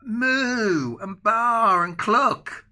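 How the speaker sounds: high, loud, slow moo, baa and cluck; farmyard animal noises. Acted. The animals bouncing the farmer out of bed, high loud slow noises. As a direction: drag out each animal noise.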